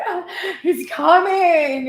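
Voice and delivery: high, slow, laughing with excitement; giddy excitement. Retold or imitated. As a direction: squeal with giddy delight. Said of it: "and you were like".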